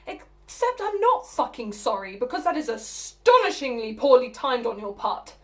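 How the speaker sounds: sudden loud flare of temper; indignant, emphatic outburst; angry retraction. Acted. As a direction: take the apology back hotly, hitting the key words. Apology flips back into anger.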